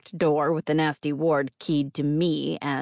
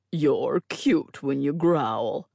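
slow teasing man's voice; teasing drawl; much slower than usual. A narrator's reading. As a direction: tease her, slow and sly. Clay teases.

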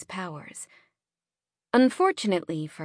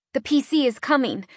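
shaky voice; 5 semitones higher, 3 dB louder than usual. A narrator's reading. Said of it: "my voice shaking slightly".

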